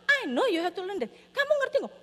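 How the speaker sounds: high-pitched retold scolding; exasperated parent. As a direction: argue back at a stubborn child. Parent argues back, retold.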